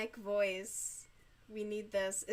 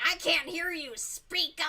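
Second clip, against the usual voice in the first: loud, higher mock yell; imitated caller shouting; 7 semitones higher, 7 dB louder than usual. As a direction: demand it like a caller who can't hear. Elderly callers demanding louder speech.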